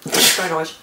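talking between spoonfuls; eating while talking. Real, not acted. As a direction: mouth partly full of salsa. Seen 0: Holds salsa jar and spoon, spooning it to her mouth while talking.

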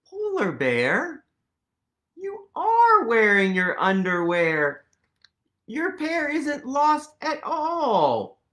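loud, amazed exclamation; surprised. Acted. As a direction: exclaim in disbelief at the bear. Surprised reveal to polar bear.

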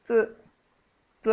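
trying to say ত with no vowel after it, stopping short; halting attempt at ত. Shown as a demonstration. Explaining khanda-ta to listeners, cut-off ত sound. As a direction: clip ত off without its vowel, twice. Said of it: "ত উচ্চারণ করতে পারছি না".

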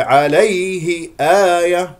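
high, slow chanted عليه آية; unpressed Y. Shown as a demonstration. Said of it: "لا تضغط عليه". (عليه آية دون ضغط على الياء)

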